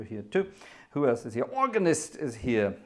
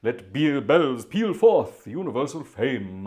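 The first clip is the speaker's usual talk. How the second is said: slow, grand, louder hymn declamation; monumental voice; 7 dB louder, slower than usual. Shown as a demonstration. Organist reading the hymn words to viewers, slow and monumental. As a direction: proclaim it like it fills a cathedral. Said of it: "monumental voice for that kind of thing".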